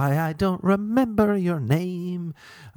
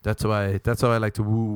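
normal talking voice

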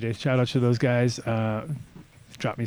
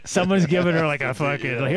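ordinary delivery

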